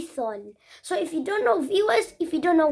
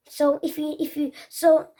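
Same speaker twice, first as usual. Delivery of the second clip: ordinary talk, nothing marked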